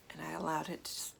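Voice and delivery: ordinary talk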